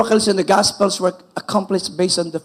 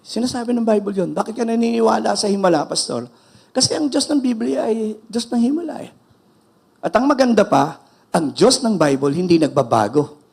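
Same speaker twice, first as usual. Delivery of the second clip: ordinary delivery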